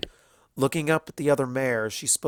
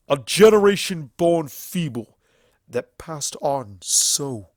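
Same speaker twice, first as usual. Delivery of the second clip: louder, slow, seething stallion tirade; furious stallion accuser; 7 dB louder, slower than usual. Acted. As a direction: condemn the tyrant with contempt.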